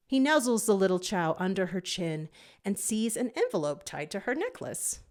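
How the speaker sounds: usual speaking voice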